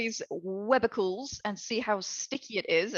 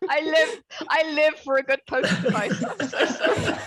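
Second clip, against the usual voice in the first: louder, higher, breaking into real laughter; helpless laughter; 4 semitones higher, 3 dB louder than usual. Real, not acted. Player apologises to the table while cracking up, helpless laughter. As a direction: struggle to speak through giggles.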